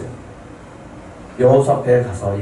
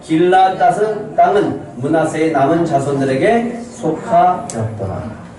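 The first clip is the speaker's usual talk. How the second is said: slow, raised congregational reading voice; loud scripture reading; 5 semitones higher, slower than usual. Real, not acted. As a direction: read it out slowly and high for all to follow. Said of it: "크게 읽겠습니다".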